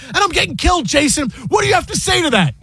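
very high, exasperated mock demand; Michael Rappaport. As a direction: imitate an upset co-host demanding an answer. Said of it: "I haven't seen you this upset".

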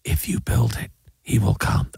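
low, guttural whispered movie line. Retold or imitated. Host imitating the Field of Dreams whisper, whispered low. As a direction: whisper it like a mysterious voice from nowhere. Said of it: "there's the whisper going on".

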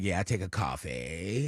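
deep creaky fry, very low and soft; vocal fry. Retold or imitated. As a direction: drop into a lazy creaky fry. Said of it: "You talk like that".

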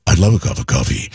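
lightly voiced seventies mogul, quick; a hint of the Penthouse owner ordering coffee; Bob Guccione impression. Guccione asking hosts for coffee, mild imitation. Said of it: "he talked exactly like this".

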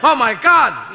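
high, loud mock shock; shocked cry. Kid shocked by a photo, high and loud. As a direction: gasp it out in horror.

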